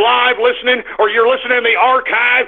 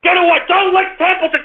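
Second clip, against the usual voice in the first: scolding the dog; 8 semitones higher than usual. Real, not acted. Scolding the dog away from beer.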